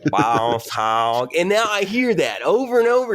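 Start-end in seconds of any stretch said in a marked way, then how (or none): 0.0-1.3 hammy American Southern drawl; stretched-out cartoon Southern accent. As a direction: imitate a Brit's put-on Southern accent. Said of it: "an American Southern accent".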